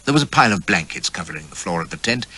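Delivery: plain reading voice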